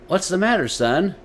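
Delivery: concerned father. A narrator's reading. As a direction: gently ask what's wrong. Father asks crying son.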